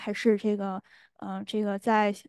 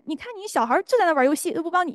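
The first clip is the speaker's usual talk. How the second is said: higher, quick needling complaint; tempting inner voice; 7 semitones higher, faster than usual. Retold or imitated. Inner voice goading a parent about the kids, quick and high. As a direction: stir up resentment, fast and high. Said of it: "或者说有家庭是".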